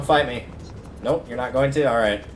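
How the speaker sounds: ordinary talk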